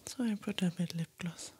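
soft whisper close to the mic; ASMR whisper. Acted. Whispering her makeup steps to ASMR viewers, soft whisper. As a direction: whisper gently, as if sitting right beside them.

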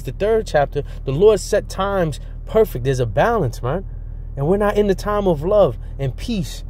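fiery preaching. Acted. Street preacher warning listeners of evil times, building fiery preaching.

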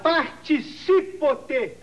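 PARTICIPOTE bem devagar, sílaba por sílaba; correção silabada. Shown as a demonstration. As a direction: stretch out each syllable of Participote, correcting a misreading. (Participote dito bem pausado, corrigindo)

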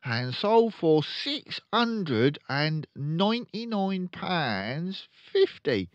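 blustering, slow, high car-dealer dad; pompous father voice; loud pompous father. Acted. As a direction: boom it out like a showy salesman. Father voiced in Matilda reading.

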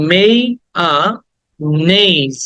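মেয়ানেজ broken up as "may ... a ... nayz"; syllable by syllable. Shown as a demonstration. Teacher breaks down the word, very slow syllables. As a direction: draw out each syllable of মেয়ানেজ with gaps. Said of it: "আগে হচ্ছে মেয়া".

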